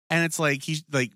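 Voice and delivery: ordinary talk, nothing marked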